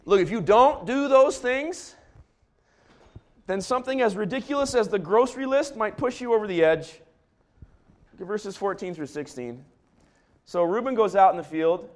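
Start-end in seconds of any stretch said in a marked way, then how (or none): 0.0-7.0 urgent warning. Real, not acted. Pastor warning couples, loud and high. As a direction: warn them hard.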